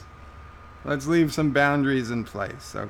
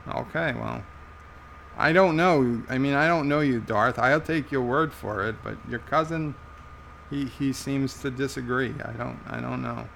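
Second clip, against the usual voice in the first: usual speaking voice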